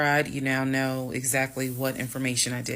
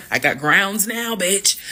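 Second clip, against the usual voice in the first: loud, higher gloating cry; triumphant; 6 semitones higher, 8 dB louder than usual. Retold or imitated. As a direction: crow it with relief, louder and higher. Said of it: "that's how I felt".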